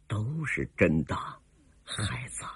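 soft, low, fading away; calm confirmation. A narrator's reading. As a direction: answer quietly, letting the last word sink. Older woman gently confirms to heroine.

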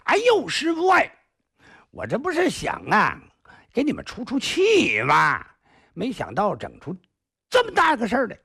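high, fast wheedling excuse; jumpy pleading. Acted. Cheeky apprentice excusing himself to his master, high wheedling voice.

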